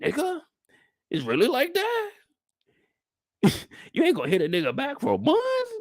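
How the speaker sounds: high, hurt, incredulous voice; indignant texter asking why no reply; quoting the texter. Retold or imitated. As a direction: play the friend, stung at being ignored. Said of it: "He said".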